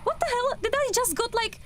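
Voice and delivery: high, breathless shock; shocked disbelief. Real, not acted. Player asking chat if she got stuck, shocked. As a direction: still reeling, pitched well up. Right after being trapped by ghost.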